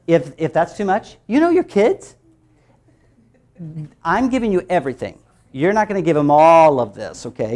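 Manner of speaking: ordinary talk, nothing marked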